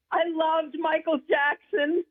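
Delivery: high, slow, giggly admission; laughing confession. Real, not acted. Guest admits a favorite singer to the host, laughing. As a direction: laugh through it, high and drawn out.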